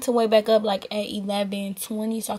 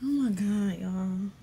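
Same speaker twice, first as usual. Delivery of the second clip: ordinary talk, nothing marked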